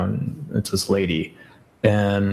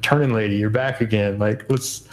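weary complaint; 3 semitones higher than usual. Retold or imitated. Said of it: "and I'm like".

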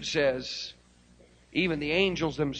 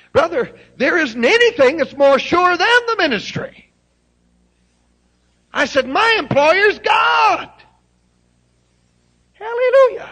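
full-force preaching; 14 semitones higher, 12 dB louder than usual. Acted. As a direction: shout it from the pulpit. Preaching climax.

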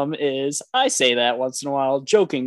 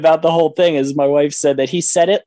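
normal talking voice

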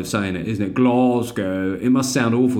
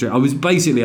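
ordinary talk, nothing marked

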